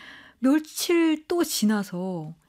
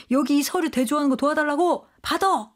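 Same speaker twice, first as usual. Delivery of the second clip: higher-pitched irritated boss demand; angry order; 5 semitones higher than usual. Acted. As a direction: act out an annoyed command, pitch jumping up. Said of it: "뭐 이러면서 막 화를 내거든요". (화내며 높은 목소리로 명령하듯)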